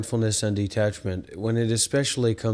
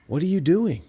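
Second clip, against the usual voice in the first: exasperated; 6 semitones higher than usual. Acted. Presses the critic again.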